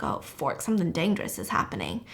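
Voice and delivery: low, soft, quick aside; hushed dread. Retold or imitated. Guests hear the door ring, low hushed alarm. Guests react fearfully to doorbell.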